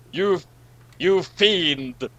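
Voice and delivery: ordinary talk, nothing marked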